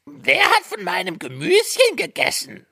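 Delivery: very high, squeaky dwarf; fourth dwarf. A narrator's reading. Fourth dwarf asks about his vegetables, very high little voice. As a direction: pipe it out high as a tiny dwarf. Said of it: "Der vierte".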